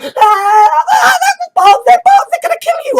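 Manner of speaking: high, loud panicked cry; terrified shouting. Acted. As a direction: yell the warning in sheer terror. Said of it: "they're going".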